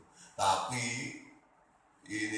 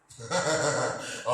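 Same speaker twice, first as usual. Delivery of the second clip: loud burst of laughter; laughing; 3 semitones higher, 10 dB louder than usual. Real, not acted. Preacher amused by his joke, loud laughing.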